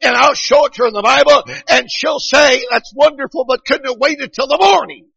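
high, loud revival preacher; fired-up preaching. Real, not acted. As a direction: preach it out with rising fervor. Preaching at full force.

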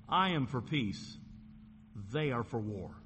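slow, measured stress contrasting "I am for peace" with "they are for war"; emphatic contrast. Shown as a demonstration. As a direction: set "I" against "they", pausing between the halves. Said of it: "The emphasis in verse seven is".

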